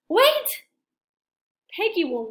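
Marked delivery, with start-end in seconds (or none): 0.1-0.6 high, loud sudden cry; sudden exclamation. Acted. Elephant stopping himself, high loud cry. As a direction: stop yourself short.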